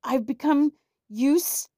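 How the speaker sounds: high, loud, puffed-out voice; huffing and puffing. A narrator's reading. Knight shooting back while huffing and puffing. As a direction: push the words out between puffs. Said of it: "huffing and puffing".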